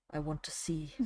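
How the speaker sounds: soft unvoiced whisper; barely audible whisper; whispered aside. Real, not acted. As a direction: drop to a hush and whisper it. Whispered aside mid-reading.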